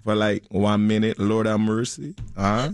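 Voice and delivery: playful mock Jamaican accent; comic Jamaican patter. Shown as a demonstration. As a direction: lilt it like a Jamaican.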